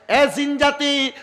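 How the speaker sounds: high, slow drawn-out call; summoning call. Acted. Prophet addresses the jinn, high drawn-out summons. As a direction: stretch out a commanding summons, pitched high. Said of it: "ডাক দিলেন দুইটা জিনকে".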